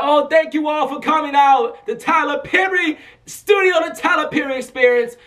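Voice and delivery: high, projected hostess announcement; Oprah. Retold or imitated. Oprah thanking guests at the studio gala, imitated hostess call. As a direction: call it out like a big TV host. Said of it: "Oprah speaks".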